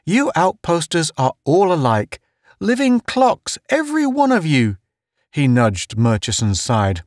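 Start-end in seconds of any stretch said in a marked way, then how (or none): 0.1-4.8 high, jovial character voice; chuckling teaser. A narrator's reading. Town teasing the outposters, high amused voice. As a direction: rib them in a high-pitched, amused voice. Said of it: "Town chuckled".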